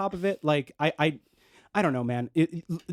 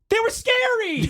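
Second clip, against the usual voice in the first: high, laughing squeal of protest; laughing defence of being scared; 3 dB louder, slower than usual. Real, not acted. Defending fear of monsters.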